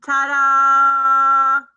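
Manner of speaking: big loud ta-da; excited reveal. Acted. Teacher revealing the music symbol to children, loud and excited. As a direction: announce it like a magic trick.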